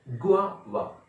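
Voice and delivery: गुआवा as gwaa, then va; correct pronunciation. Shown as a demonstration. Teacher restating the real pronunciation, gwaa-va demonstration. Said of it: "असली pronunciation है".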